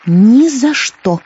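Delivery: slow, high defiant refusal. Acted. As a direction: refuse her defiantly and slowly. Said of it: "с вызовом сказала она".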